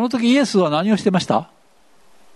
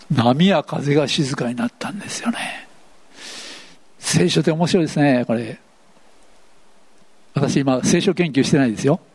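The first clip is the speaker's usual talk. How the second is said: ordinary talk, nothing marked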